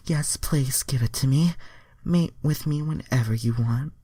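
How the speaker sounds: put-on high pleading girl voice; Starfire voice. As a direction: beg sweetly, pitched high. Starfire begging in fanfic.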